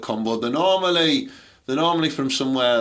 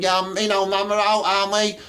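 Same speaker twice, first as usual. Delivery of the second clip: higher mocking girl voice; mock daughter; 7 semitones higher than usual. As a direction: ham up the daughter in the bar. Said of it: "She went".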